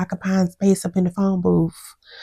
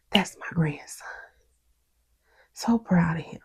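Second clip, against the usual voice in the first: mock-tender whisper, much softer; whispered proud grandpa; 7 dB quieter than usual.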